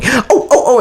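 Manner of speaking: imitating a flustered woman, very high squeaky oh's; retold assistant panicking, high-pitched oh's; mock high flustered oh's.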